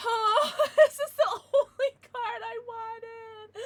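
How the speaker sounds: slow, high, loud words between sobbing laughs; real happy tears breaking through laughter; laugh-crying. Real, not acted. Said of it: "I'm kinda crying".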